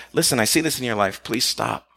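tender, hushed entreaty; loving appeal. Acted. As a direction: gently confront someone you care about. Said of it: "somebody who loves you when they're like".

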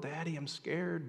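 soft, hushed plea to Daddy; gently voiced frightened child; small scared child. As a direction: drop to a child's quiet confession.

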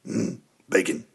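low, soft, breathy Carl murmur; imagining Carl asking for bacon; Carl from Sling Blade. Retold or imitated. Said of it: "imagine him going".

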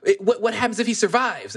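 high, put-on fretful voice; a hammed-up worried question; mock worried voice. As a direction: play the nervous sidekick asking. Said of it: "he's, "Well".

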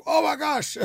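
high-pitched, thrilled exclamation; amazed delight. Retold or imitated. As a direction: imitate someone overjoyed at a discovery. Retells artists' delighted discovery.

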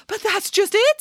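high, insistent little voice; high-pitched storybook urgency; pleading chicken. A narrator's reading. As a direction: protest in a squeaky chicken voice.

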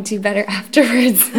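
ordinary delivery

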